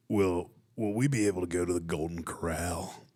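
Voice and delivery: low, put-on buffet lover; buffet customer voice. Diner hoping to go to Golden Corral, buffet voice. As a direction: play an earnest devotee of buffets. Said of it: "do your best buffet voice".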